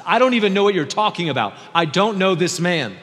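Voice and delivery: loud, high-pitched outburst; heated denial. Acted. Peter denying he knows the man, loud and vehement.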